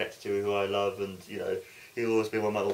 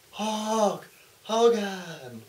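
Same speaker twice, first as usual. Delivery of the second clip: very slow, high, drawn-out name call; ring announcer intro; 10 semitones higher, much slower than usual. Acted. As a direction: announce him to the crowd. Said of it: "I'm doing it for the crowd".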